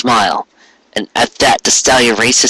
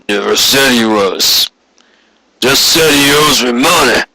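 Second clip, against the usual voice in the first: gruff boss Quarry. A narrator's reading. Boss calling from his office to his clerk, a cynical lecturing voice. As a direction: voice him as a hard-nosed boss laying down the law.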